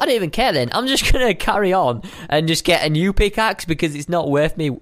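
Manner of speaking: usual speaking voice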